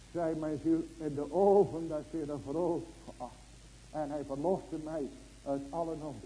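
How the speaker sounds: normal talking voice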